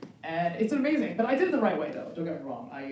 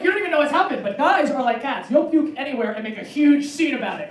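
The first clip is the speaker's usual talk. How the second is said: ordinary talk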